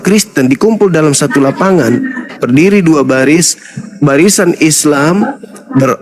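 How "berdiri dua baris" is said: normal talking voice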